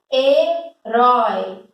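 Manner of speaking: "Herói" drawn out slowly, syllable by syllable; slow down and split "Herói" so each syllable is heard; "Herói" said very slowly, with every syllable stretched. Shown as a demonstration. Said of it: "Eu divido sílaba".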